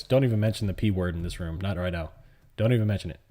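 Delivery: ordinary talk, nothing marked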